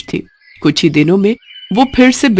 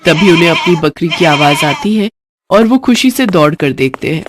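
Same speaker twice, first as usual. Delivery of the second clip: ordinary delivery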